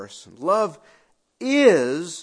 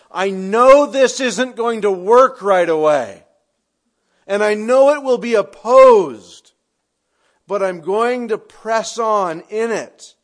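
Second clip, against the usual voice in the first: determined proclamation; 4 semitones higher, 10 dB louder than usual. Acted. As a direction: declare it, full force, as love itself. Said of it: "nature of love that says".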